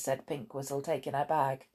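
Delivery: ordinary delivery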